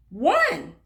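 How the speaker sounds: high, loud triumphant "Three"; cheery answer. Acted. Teacher to children counting buttons, bright loud answer. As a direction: announce the answer proudly to kids.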